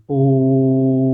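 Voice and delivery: ओम held very long and steady; prolonged om. Shown as a demonstration. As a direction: chant ओम with a long held vowel. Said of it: "लंबा समय". (लंबा खींचा हुआ ओम)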